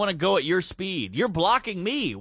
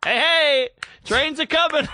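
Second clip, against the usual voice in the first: loud, higher call to clear the stairs; 8 semitones higher, 5 dB louder than usual. Retold or imitated. Running for the train, calling out to the couple blocking the stairs. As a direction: shout a cheery warning down the steps. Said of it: "So I go".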